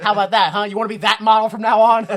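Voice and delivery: loud taunting challenge; mock threat. Threatening an appliance with the noisy model, loud mock taunt. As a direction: lean in loudly on a mock warning.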